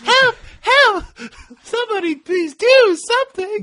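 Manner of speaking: shrill high panicked cries for help; frantic shouting hostage. Retold or imitated. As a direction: scream it like a helpless hostage. Quoting Bo Peep's cries in stick-up.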